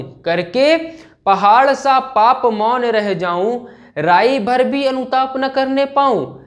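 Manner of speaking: slow, stretched poetic lines; dramatic verse recitation. Acted. Teacher recites the next verse for students, slow declamation. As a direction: declaim slowly, drawing out the line endings.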